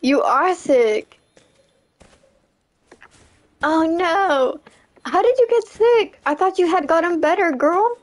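usual speaking voice

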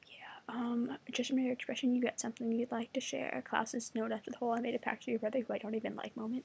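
usual speaking voice

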